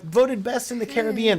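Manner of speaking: hammed-up advertising slogan read; mock slogan. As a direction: sell it like a cheesy tagline.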